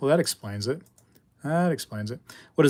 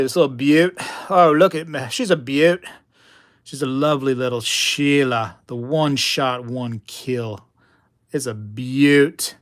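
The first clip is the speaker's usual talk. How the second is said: hammed-up Australian accent, drawn out on key words; 6 dB louder, slower than usual. Showing viewers a little silver beauty, Australian accent. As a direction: put on a playful Aussie accent. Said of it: "Australian accent".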